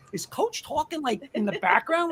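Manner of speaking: high, hushed puzzled question; retold bafflement. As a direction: replay your baffled double take, softly. Retelling puzzled reaction to voice.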